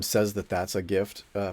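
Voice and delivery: usual speaking voice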